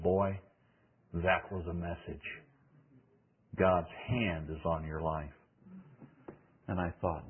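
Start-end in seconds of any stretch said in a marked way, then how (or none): 0.0-5.3 soft, low and sincere; tender verdict. Retold or imitated. Mentor quietly telling the young preacher his message was real, soft and tender. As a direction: lay a blessing on him gently.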